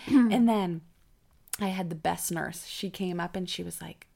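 usual speaking voice

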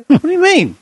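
high-pitched, baffled comeback; incredulous. Real, not acted. Storyteller balking at a friend's interruption, incredulous. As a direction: throw the question back in disbelief. Balks at interrupting lockdown question.

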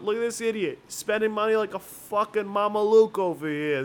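razz the sucker in a hint of Yiddish; yiddish accent. Bottle staff mocking a big spender.

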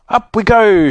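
high, merry storybook shout. A narrator's reading. Joe to the others as the basket rises, shouted. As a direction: call it out as the basket lifts. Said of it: "shouted Joe".

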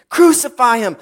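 high, loud demand; angry crowd cry. Acted. Preacher echoes the crowd's cry that Jesus carries, loud anger. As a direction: throw it out as a mob's cry. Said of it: "And then comes another cry".

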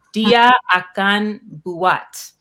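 very slow, loud, separated words; deliberate, projected pronunciation attempt; careful foreign reading. Real, not acted. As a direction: sound out each unfamiliar word. Reading out unfamiliar foreign phrase.

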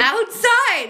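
high, loud surprised exclamation; surprised outside. Real, not acted. Friend asks if it was outside, high loud surprised reply. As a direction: blurt it out in delighted surprise.

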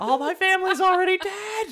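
high, loud laughing wail; whiny wail. Pretend. Voicing revived fossil Pokemon.

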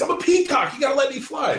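high, shouted movie-quote impression; hammed-up indignant outburst; Mark Wahlberg. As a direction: yell it high like an overwrought hothead. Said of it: "Mark Wahlberg in The Other Guys".

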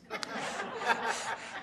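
a real burst of laughter, rising then falling; short laugh. Real, not acted. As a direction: laugh at how absurd it was. Laughing at the absurd situation.

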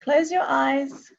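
slow, calm meditation voice; guided meditation. Acted. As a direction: guide them gently, slowly. Leads a meditation.

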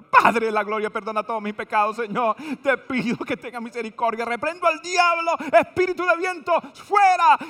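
panicked passenger rebuking the devil; mock frantic prayer. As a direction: cast out the wind spirit like a preacher. Frantic prayer on shaky plane.

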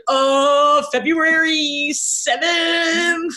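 high, stretched-out mock diary voice; imagined diarist. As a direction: draw out each word, high and theatrical. Imagines a diarist cramming entries.

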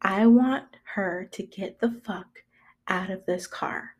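near-whispered demand with deliberate pauses; cold roommate demand. Retold or imitated. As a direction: imitate quiet, contained anger. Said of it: "my roommate proceeds to say".

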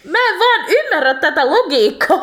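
high, loud laughing despair; laugh-cry at a plot that makes no sense; exasperated laugh. Real, not acted. Podcaster venting about the book's logic, laughing in exasperation. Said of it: "mä olin tosi turhautunut".

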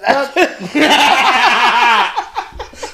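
big high laugh bursting out. Real, not acted. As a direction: howl with laughter. Laughing at the alien joke.

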